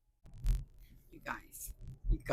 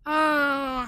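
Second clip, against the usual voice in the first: ordinary delivery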